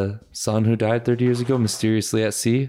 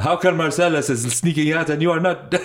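put-on higher-pitched, Indian-sounding accent; botched Jamaican accent sounding Indian; 6 semitones higher than usual. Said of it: "Yo, you're doing Indian".

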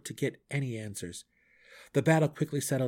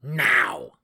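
shouted command; 10 dB louder than usual. Acted.

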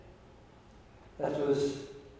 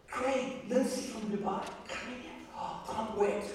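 hushed excited quote; 5 semitones higher, 8 dB quieter than usual. Retold or imitated. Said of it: "And she said".

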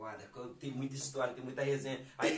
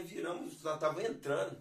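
ordinary talk

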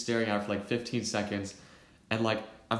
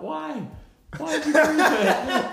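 much higher, louder, laughing through the words; laughing disbelief; 8 dB louder, slower than usual. Retold or imitated. Narrator asking friends why they gave a pig head, laughing disbelief. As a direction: cry out in giggling disbelief.

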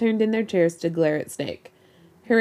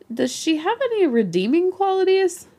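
much higher, disbelieving question; high incredulous voice; 12 semitones higher than usual. Acted. Incredulous question about the woman.

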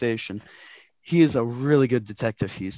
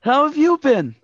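put-on a much higher, louder young boy's voice; boy detective; 12 semitones higher, 7 dB louder than usual. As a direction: play the eager kid detective greeting his boss. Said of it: "Angus McDonald, boy detective".

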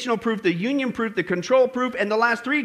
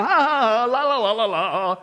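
mock laughing. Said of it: "Aren't they laughing at us".

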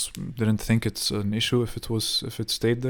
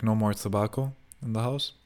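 ordinary delivery

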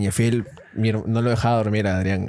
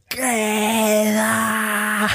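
high, long called-out word; mock shout at take's end; shouted cut; 11 semitones higher than usual. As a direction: yell it at the end of the take. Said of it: "gritar re duro".

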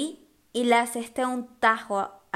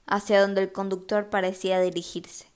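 ordinary delivery

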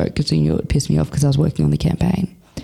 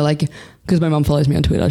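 ordinary talk

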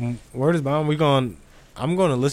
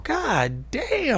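high, soft, stunned exclamation; real amazement; 8 semitones higher, 6 dB quieter than usual. Real, not acted. Co-host hearing twenty-four people came, high stunned amazement. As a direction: react in high-pitched disbelief.

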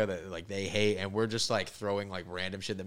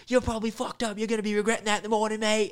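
high pitched, put-on foreign voice; mocking local guy; 14 semitones higher, 5 dB louder than usual. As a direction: imitate the baffled local. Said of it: "looks at me and goes".

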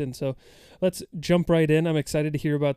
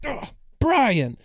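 sudden recall; 9 semitones higher, 5 dB louder than usual. Retold or imitated. Name finally pops into head.

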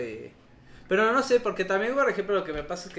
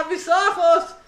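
mock cry; 9 semitones higher, 7 dB louder, slower than usual. Mocking friend blinded by curtains.

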